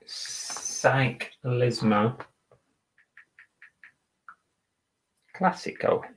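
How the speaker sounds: "Cyclismo Classico" breathed out voiceless and slow, syllable by syllable; whispering while typing. Real, not acted. Seller typing the listing title, whispering to himself. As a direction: mouth "Cyclismo Classico" under your breath as you type. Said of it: "gotta spell it right".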